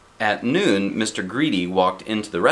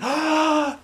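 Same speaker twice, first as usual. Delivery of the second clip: quick gasp for air. Acted. Chatterbox gasping for air.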